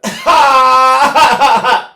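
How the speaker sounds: put-on high, loud baby wail from the belly; baby cry shout. Shown as a demonstration. Voice teacher shows how a baby cries, loud high wail. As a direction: let out a big belly-driven baby cry. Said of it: "they pull in and go".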